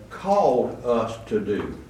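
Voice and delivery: "called" drawn out slow and weighted; stress on called. Shown as a demonstration. Preacher slowing to stress "called" for the congregation. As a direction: lean on "called" slowly. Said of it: "I'm emphasizing the word call".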